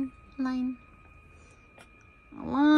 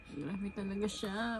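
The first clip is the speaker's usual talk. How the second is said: usual speaking voice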